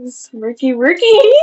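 loud, drawn-out silly nickname; playful singsong. Real, not acted. Teasing the viewers with a nickname, playful singsong. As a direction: stretch it out, climbing at the end.

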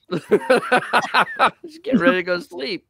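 laughing through the words. Real, not acted. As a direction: giggle it out, slow and high. Laughing while talking about cat.

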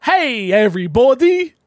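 "everybody" stretched out high and loud. Retold or imitated. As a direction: draw out "everybody" like a greeting. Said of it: "That's what it says".

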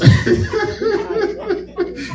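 real high-pitched burst of laughter; high laugh mid-story. Real, not acted. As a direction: laugh out at the memory. Telling a funny story.

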